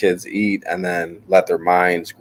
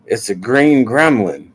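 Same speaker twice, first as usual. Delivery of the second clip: lightly voiced little kid, slow; a hint of a proud child; imitating his son; 4 semitones higher, 3 dB louder than usual. Son showing his drawing, lightly voiced child. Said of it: "he was like".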